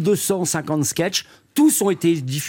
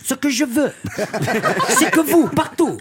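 put-on high, rising imperial proclamation with a light Corsican accent; Napoleon with Corsican accent; 9 semitones higher than usual. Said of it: "je suppose qu'il avait un petit accent corse".

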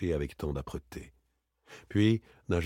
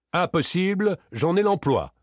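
firm rebuff; 6 semitones higher, 5 dB louder than usual. Acted. Daspry rebuffs the armed man.

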